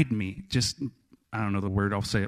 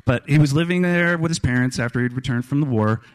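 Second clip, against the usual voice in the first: normal talking voice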